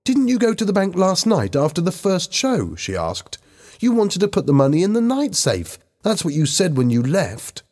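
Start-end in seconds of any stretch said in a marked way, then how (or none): 0.0-2.7 high-pitched challenging question; accusing. A narrator's reading. Lisa questions Bobby about the bank, accusing. 3.8-7.6 high-pitched reproach; accusing. A narrator's reading. Lisa reminds Bobby what he said, accusing.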